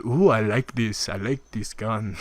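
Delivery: slow mock Japanese accent; understated put-on Japanese accent; Shigeru Miyamoto. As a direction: lightly imitate halting Japanese-accented English. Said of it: "Japanese guy who can't speak English at all".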